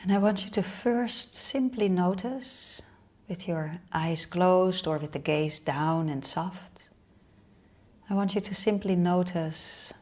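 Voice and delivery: unhurried meditation guide with long pauses; guided meditation. Real, not acted. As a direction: lead them inward in short, spaced-out phrases.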